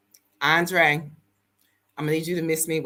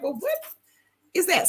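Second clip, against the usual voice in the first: curious surprise; 12 semitones higher than usual. Retold or imitated. Spotting the building.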